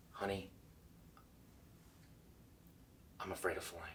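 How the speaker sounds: hushed, soft confession with pauses; like a secret. Shown as a demonstration. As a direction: share it as a secret. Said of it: "deliver it like it's a secret".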